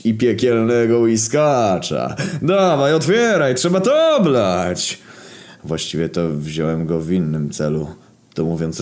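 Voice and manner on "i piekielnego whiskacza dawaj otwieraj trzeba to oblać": drawn-out, rising celebratory cheer; delighted. Acted. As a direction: eagerly urge everyone to drink. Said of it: "ucieszył się śmierć".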